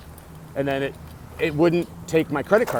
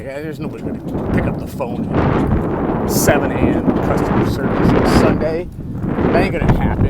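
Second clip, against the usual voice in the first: skeptical grumble; 4 dB louder than usual. Retold or imitated. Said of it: "and I'm like".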